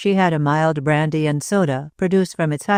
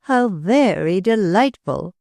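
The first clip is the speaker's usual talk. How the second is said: "very" drawn out and leaned on, slowly; stress on very; 4 semitones higher, slower than usual. A narrator's reading. Hostess gushing at Claude's news, stress on "very". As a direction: gush and lean hard on "very". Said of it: "with the accent on the very".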